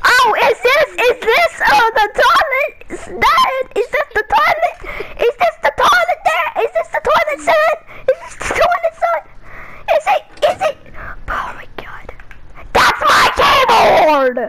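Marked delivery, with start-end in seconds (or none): 0.1-10.8 high, laughing dad voice; dad voice asking. Acted. Dad asks about toilet, laughing. 12.7-14.4 loud, slow panicked yell; alarmed shout. Acted. Son shouts as dad nears keyboard.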